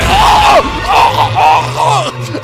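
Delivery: high, loud, breathy cut-off shriek; mock death yelp; strangled cry. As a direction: get silenced mid-word. Trainer silenced by Pokemon.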